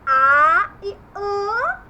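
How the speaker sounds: loud, slow contrast of "A" and "an"; two vowel sounds. Shown as a demonstration. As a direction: open "A", then nasal "an", both held. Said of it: "pode ter dois sons".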